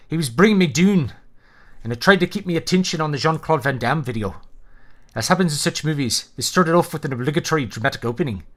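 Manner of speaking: reading in a mock Scottish accent; a light put-on Scottish accent, reading aloud. Shown as a demonstration. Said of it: "trying to replicate a Scottish accent".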